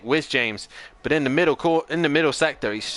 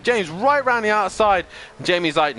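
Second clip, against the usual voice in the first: ordinary talk, nothing marked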